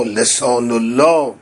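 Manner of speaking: ordinary delivery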